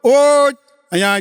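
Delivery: mild loud call to the waiter; old uncle summoning the waiter. Retold or imitated. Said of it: "કાકા વેટરને બોલાવ્યો". (વેટરને બોલાવતો હળવો સાદ)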